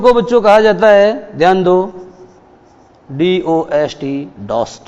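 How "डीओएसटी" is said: "डीओएसटी" letter by letter, slowly; spelled out slowly. Shown as a demonstration. Teacher spelling the word out slowly for the students. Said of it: "poetry में इसको डॉस्ट लिखा जाता है".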